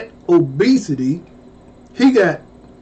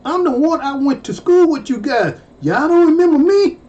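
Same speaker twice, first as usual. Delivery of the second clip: put-on pitched-up protest to old classmates; insistent correction; 7 semitones higher than usual. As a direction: make them remember you. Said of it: "I said".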